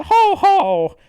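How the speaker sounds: high, loud Santa-like laugh; hammed-up ho-ho; Robotnik. Villain's head laughs like Santa, loudly. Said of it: "Robotnik's head says".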